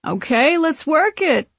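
high, sing-song hype; tipsy diva energy; giddy showing off. A narrator's reading. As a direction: strike a pose with your voice. Drunk fan poses for selfie.

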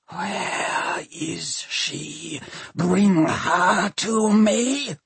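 slow, high undead machine demanding; drawn-out inhuman demand; horrible voice. A narrator's reading. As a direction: start hushed, swell into an eerie command. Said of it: "It is a horrible voice".

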